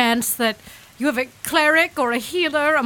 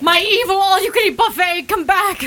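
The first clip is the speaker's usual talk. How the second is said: calling out; 6 semitones higher than usual. Acted. Calling after the departing fish.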